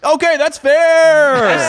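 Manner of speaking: loud, high quiz host voice; game show announcer. Acted. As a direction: rattle off the rules like a quiz host. Host voice, slow.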